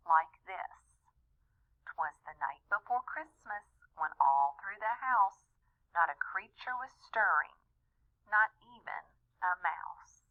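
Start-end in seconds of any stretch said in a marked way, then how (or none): 1.8-10.2 a gentle bedtime-story recital; soft poem reading. Acted. Dad reading the children their favorite story, gentle recital.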